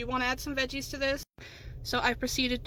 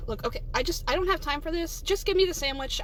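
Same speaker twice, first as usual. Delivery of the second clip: louder, higher, exasperated; impatient demand; 4 semitones higher, 6 dB louder than usual. Acted. Customer demands sandwich from worker, exasperated. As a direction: push the demand with rising impatience.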